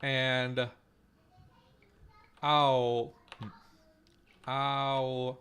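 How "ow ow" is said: "ow" drawn out with its glide intact; long, isolated demo of "ow"; full diphthong. Shown as a demonstration. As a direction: sound out "ow" slowly, ah sliding to oo. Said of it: "goes to".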